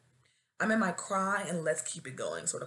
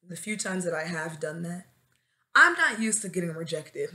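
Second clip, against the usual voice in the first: normal talking voice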